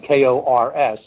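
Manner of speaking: each letter said apart, K O R S; spelled letter by letter. Shown as a demonstration. Podcast host spells a designer's name for listeners, letter by letter. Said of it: "it is spelled".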